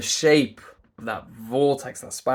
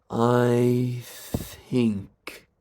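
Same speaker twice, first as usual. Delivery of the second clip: "I think" with long "I" and lengthened "th"; slowly. Shown as a demonstration. Coach repeating the demo for listeners, slowly. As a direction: stretch out the sounds of "I think". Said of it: "If I do it really slowly".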